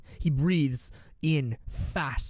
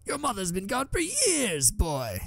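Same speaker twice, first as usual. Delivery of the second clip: Watto's voice; 8 semitones higher than usual. A narrator's reading. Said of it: "he laughs".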